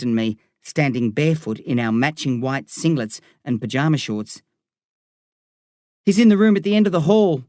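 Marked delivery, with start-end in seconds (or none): 6.1-7.4 higher, louder, quick call. A narrator's reading. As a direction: call down to the paramedics, fast and raised. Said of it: "I call".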